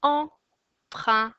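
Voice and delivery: "empreintes" in three loud, spaced beats; syllable by syllable. Shown as a demonstration. Teacher names the last drawing, syllable by syllable. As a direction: break "empreintes" into em, prein, tes with pauses. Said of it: "Trois syllabes".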